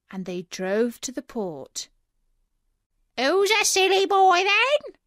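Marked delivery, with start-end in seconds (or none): 3.2-5.0 loud, very high parrot screech; squawking parrot. A narrator's reading. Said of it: "squawked the parrot".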